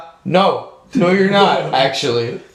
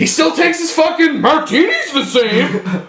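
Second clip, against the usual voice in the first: mock outrage; 9 semitones higher than usual.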